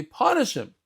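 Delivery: put-on high, pleading child tattling to his father; child runs to father at the door, high and whiny; whiny little kid. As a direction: play a small kid crying to daddy for revenge. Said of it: "They say".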